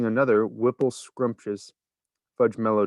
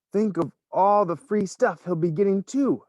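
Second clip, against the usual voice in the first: envious; 9 semitones higher than usual. A narrator's reading. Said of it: "another boy said enviously".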